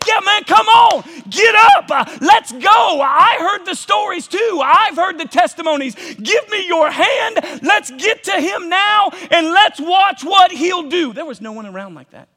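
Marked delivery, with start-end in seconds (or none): 0.1-11.1 loud, high eager urging; rally him up off the ground; imagined friend urging Bartimaeus to go to Jesus; excited friend. Acted. Voicing an encouraging friend.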